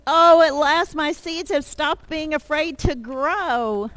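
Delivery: high, loud storybook delight; rejoicing Toad. Acted. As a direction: exclaim it with acted relief and joy. Toad rejoices at sprouted seeds.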